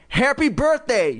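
high, squeaky dummy greeting; high ventriloquist's dummy voice; Slappy declaring. A narrator's reading. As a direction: declare it high like a party puppet. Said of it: "she made Slappy declare".

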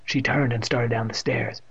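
ordinary delivery

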